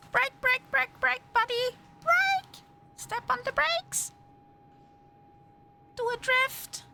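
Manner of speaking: high, urgent nagging brake calls; nagging backseat driver. Acted. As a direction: yell at the driver to brake, hammed up. Yelling driving orders at pilot.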